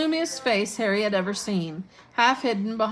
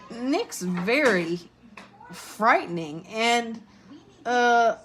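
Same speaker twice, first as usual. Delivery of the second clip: faltering; 4 semitones higher, much slower than usual. A narrator's reading. Harry improvises haltingly under pressure.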